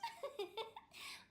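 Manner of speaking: bright high little laugh; playful high giggle. Real, not acted. Director giggling at Meng's shocked reaction. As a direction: giggle it off, pleased with herself. Replies to remark about smile.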